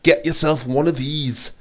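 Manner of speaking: put-on rough, amateur Scottish accent; attempted Scottish accent. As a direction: imitate a Scottish salesman making his pitch. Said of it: "attempt at Scot-, Scottish accent".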